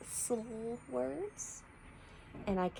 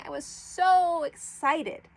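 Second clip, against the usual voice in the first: high, loud burst of real excitement; thrilled; 9 semitones higher, 7 dB louder than usual. Real, not acted. Teacher telling her class how excited she was about her flowers. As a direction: let the joy spill out. Her peonies came up in spring.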